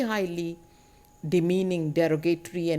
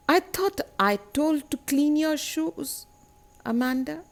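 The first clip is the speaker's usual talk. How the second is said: acted parental reproach, pitched higher; nagging parent; 7 semitones higher than usual. Parent reminding Amanda about cleaning shoes, nagging. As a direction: chide the child in a raised pitch. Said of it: "the nagging attitude of her parents".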